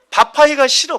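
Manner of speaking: mock the wife's cooking complaint with a whine; whiny complaint. Wife complaining about cooking in the heat, loud mock whine.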